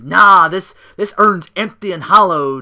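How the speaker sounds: loud, sneering jab; mocking taunt. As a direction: taunt him about the empty urn. Wyatt taunting Undertaker with the urn.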